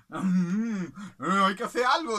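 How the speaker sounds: animals plotting. Acted. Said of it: "dijeron los animales".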